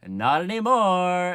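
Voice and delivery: loud, high, slow drawn-out cry; hammed-up killer's battle cry; mock menacing shout. Sweeney about to murder the judge, mock shout.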